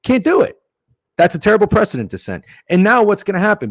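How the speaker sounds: fired-up rant; heated rant. Real, not acted. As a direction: vent it loudly like a sports fan fed up. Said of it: "detour your rant".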